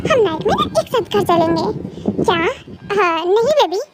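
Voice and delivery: ordinary delivery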